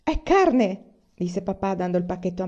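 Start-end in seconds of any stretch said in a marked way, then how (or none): 0.0-0.8 higher, louder happy cry; delighted surprise announcement; triumphant reveal to the family; joyful reveal. A narrator's reading. Father reveals the meat.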